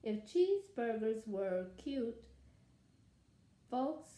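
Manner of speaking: lively read-aloud as the wolf telling his side; story narration. A narrator's reading. Wolf narrator telling children his side, storytelling voice. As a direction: read it like a sly storyteller to kids. Reads picture book as wolf.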